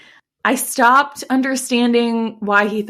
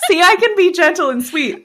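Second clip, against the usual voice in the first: mock sweet, laughing; 8 semitones higher, 4 dB louder than usual. Mocking Heathcliff, laughing.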